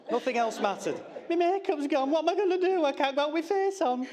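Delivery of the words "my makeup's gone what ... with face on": high, whiny, hammed-up wail over lost makeup; mock despair. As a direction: play the daughter as a drama queen, pitched way up. Mimics daughter's makeup despair.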